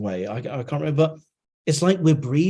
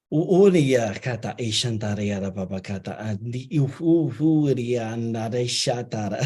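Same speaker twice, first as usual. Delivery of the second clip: flowing channeled chant of unknown words; light language; 3 semitones lower than usual. Acted. Channeler voicing beings for the guest, in a flowing chant. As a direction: let the syllables stream as if received.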